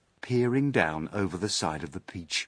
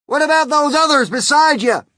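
very high, loud shout; shouted question; 12 dB louder than usual. A narrator's reading. Police chief questioning the boy above, shouted question. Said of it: "shouted the chief of police".

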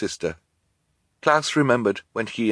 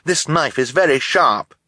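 raised, higher warning; crying out; 7 semitones higher, 4 dB louder than usual. A narrator's reading. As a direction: warn them off urgently. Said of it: "Klaus cried".

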